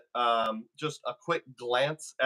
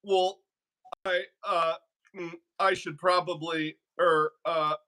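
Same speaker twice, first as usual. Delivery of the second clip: higher-pitched, halting mock stammer; hesitant higher voice, broken by fillers; Principal Skinner stammering; 4 semitones higher than usual. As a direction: imitate someone caught out, stumbling for the right words. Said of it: "stammering and stumbling to say the right thing".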